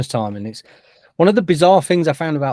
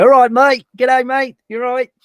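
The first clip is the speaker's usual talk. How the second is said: high, louder mock-Aussie greeting; mock Australian accent; 11 semitones higher, 7 dB louder than usual. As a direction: ham up an Australian accent, pitched well up. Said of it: "a bit of an Australian accent".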